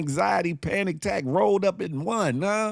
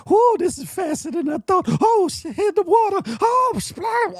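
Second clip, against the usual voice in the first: much higher, louder squealing voice; mock squeal; 5 dB louder than usual. Host imitating the woman sliding into water, high and shrill. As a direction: shriek it like a woman flying down a slide. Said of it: "She came around that bend like".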